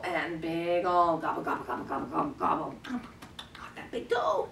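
low, laughing munching; pretend gobbling. Carer pretending to eat baby's big toe, low playful gobbling. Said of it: "we're gonna pretend to gobble".

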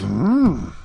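ordinary delivery

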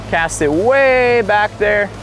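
ordinary talk

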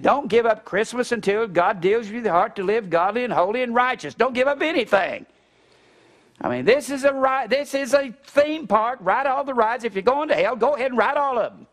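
high, loud preaching retort; fired-up retort. Retold or imitated. Preacher answering his brother-in-law, fired-up retort. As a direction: hammer it home like a revival preacher.

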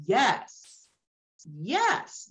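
rising eager yes, yes; excited yes. Retold or imitated. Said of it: "I was like".

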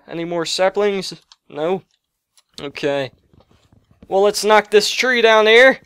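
ordinary talk, nothing marked

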